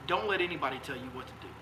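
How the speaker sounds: ordinary delivery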